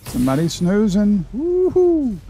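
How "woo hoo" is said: excited cheer. Real, not acted. Cheering in game.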